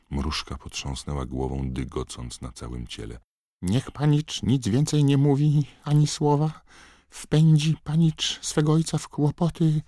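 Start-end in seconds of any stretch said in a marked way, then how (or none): 3.6-9.8 much higher squeaky elf voice, pleading; a frightened little elf begging him to stop; begging house-elf. A narrator's reading. House-elf Mrużka pleading with young master to stay silent, squeaky and frightened.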